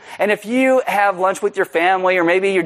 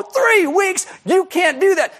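outraged shout; 14 semitones higher, slower than usual. Retold or imitated. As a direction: protest at full voice. Teen protesting loudly.

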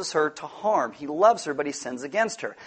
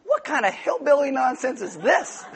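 higher, laughing incredulous scoff; a king scoffing at a couple's flirting, laughing; mock incredulous king; 8 semitones higher than usual. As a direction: scoff at the nonsense with a chuckle. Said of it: "he's like".

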